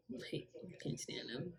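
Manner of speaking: whispered under her breath; whispered aside. Real, not acted. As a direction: hiss it to yourself. Muttered aside about managers.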